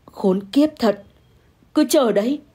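understated seething curse; through gritted teeth. A narrator's reading. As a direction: mutter a threat through clenched teeth. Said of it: "Tôi nghiến răng nghiến lợi". (nghiến răng nghiến lợi, hằn học)

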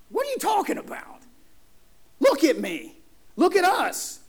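squeaky, high-pitched disbelief; incredulous protest. Acted. Gideon balking at being called a man of valor, incredulous. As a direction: jump up high in disbelief, short separate phrases.

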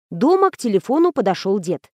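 usual speaking voice

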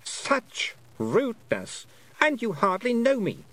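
high, offended regal voice; affronted Queen. A narrator's reading. Queen to the rude girl, high affronted voice. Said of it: "she said".

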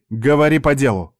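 impatient order. A narrator's reading. Mitsuka fights herself, cutting off uncle angrily. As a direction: cut in and demand the point. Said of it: "Мицука сжала кулаки".

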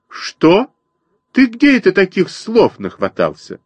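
high indignant bird mother; outraged sparrow mother. A narrator's reading. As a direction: snap back in outrage.

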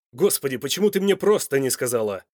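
loud, exasperated question; angry. A narrator's reading. Son reproaches his mother for her secret, angrily. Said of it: "сердито спросил Данте".

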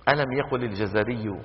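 ordinary talk

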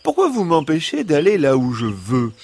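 high-pitched fox pleading its case; high, protesting animal-character voice; imploring fox. A narrator's reading. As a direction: implore as a wronged storybook fox. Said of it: "Implora le renard".

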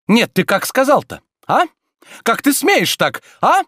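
high, loud, outraged questioning; indignant. A narrator's reading. Master challenging rude servant, indignant and high.

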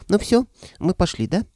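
high-pitched impatient creature voice; hurried squeaky head. A narrator's reading. Left dragon head rushing to leave, squeaky and hurried. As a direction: squeak it, eager to be off. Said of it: "а левая заторопилась".

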